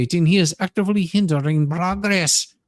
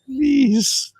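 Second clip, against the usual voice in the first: mock pleading; 4 semitones higher, slower than usual.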